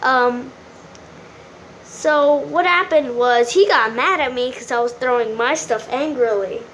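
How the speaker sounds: normal talking voice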